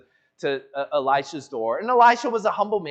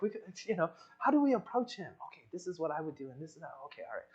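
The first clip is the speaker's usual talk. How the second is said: hushed huddle; 12 dB quieter, faster than usual. Retold or imitated. Servants huddling, planning in secret.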